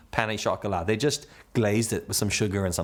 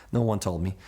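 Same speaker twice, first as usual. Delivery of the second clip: normal talking voice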